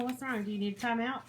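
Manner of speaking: usual speaking voice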